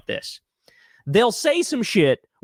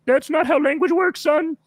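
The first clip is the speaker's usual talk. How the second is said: higher, smug parody voice; condescending mock; 8 semitones higher than usual. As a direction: talk down to the kid. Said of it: "He's like".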